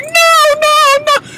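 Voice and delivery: very high shrill mock wail; hysterical wife. Recapper voicing the wife shrieking as he drives off, very high. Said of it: "she was just like".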